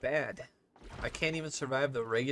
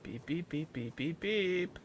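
high beeps climbing, the last drawn out; slowing turret beeps, rising in pitch; imitated auto turret beeps; 5 semitones higher, slower than usual. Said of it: "I'm an auto turret".

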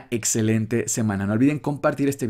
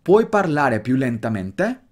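Italian question in one connected, measured flow; more natural speed. Shown as a demonstration. Teacher repeats the question for students at more natural speed. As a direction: link the words at a slightly more natural speed. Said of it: "ahora velocidad un poquito más natural".

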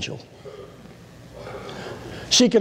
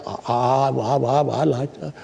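stuttering woman; 2 semitones lower than usual. Retold or imitated. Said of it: "she stuttered".

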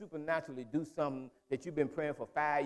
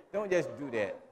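ordinary talk, nothing marked

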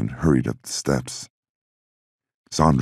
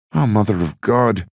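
despairing groan; 8 semitones higher than usual. Acted. Bobby despairs.

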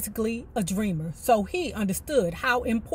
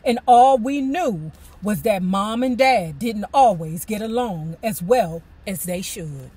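ordinary talk, nothing marked

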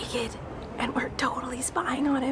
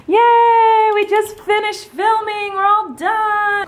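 high, loud, drawn-out hurray; celebratory cheer; 9 semitones higher, 9 dB louder, much slower than usual. Acted. Host celebrates that filming is finished, loud high cheer. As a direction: cheer it out with long held words.

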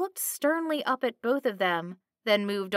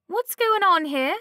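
high, loud call across the room; loud startled call; 10 semitones higher, 6 dB louder than usual. A narrator's reading. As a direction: call out from behind the counter, alarmed. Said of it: "Miss Green called from the counter".

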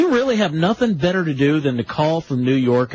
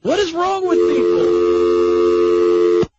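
high, loud, slow cry of despair; exasperated outburst; 10 semitones higher, 4 dB louder, much slower than usual. Acted. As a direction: throw up your hands at the callers. Host exasperated by prank callers.

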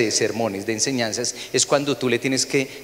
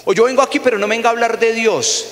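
much higher, louder defiant voice; defiant visitor; 9 semitones higher, 4 dB louder, faster than usual. Retold or imitated. A pastor mimicking people who come to challenge, defiant. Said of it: "la gente siempre piensa".

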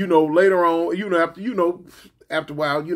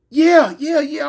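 hollering back; 9 semitones higher, 6 dB louder, slower than usual. Retold or imitated. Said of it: "I hear her holler through the house".

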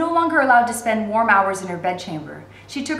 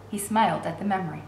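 ordinary delivery, nothing marked

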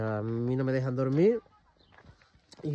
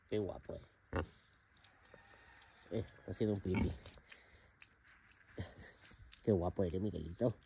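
much softer, low and fond; tender praise; 6 semitones lower, 14 dB quieter than usual. Real, not acted. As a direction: praise the animal softly up close. Praising the pet up close. (elogio tierno en voz baja)

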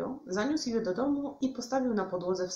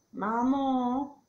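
loud, higher call to mum; bored whine; 6 semitones higher, 7 dB louder than usual. A narrator's reading. Bored Witek calls to his mum, whining. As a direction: whine for mum's attention.

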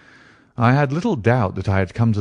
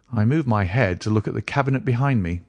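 usual speaking voice

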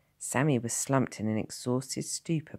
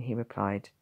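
ordinary delivery, nothing marked

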